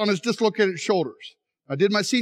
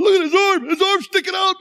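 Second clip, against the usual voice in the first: high, panicked cry of alarm; retold panic, pitched way up; freaking out; 10 semitones higher, 4 dB louder than usual. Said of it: "And I was freaking out".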